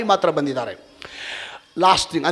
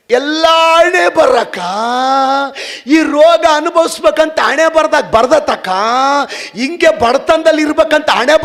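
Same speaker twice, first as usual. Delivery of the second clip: mock fatalistic lament; 11 semitones higher, 8 dB louder than usual. Preacher mocking people who blame fate, whining. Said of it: "ಕೆಲವ್ರು ಅಂತಾರೆ".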